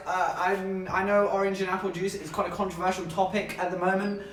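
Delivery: ordinary delivery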